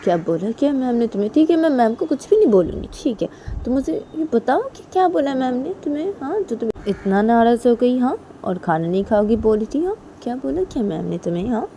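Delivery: high-pitched, lively questioning; acted mum pressing her child to tell; lifted, insistent coaxing; coaxing mother. Mother coaxing upset daughter to talk.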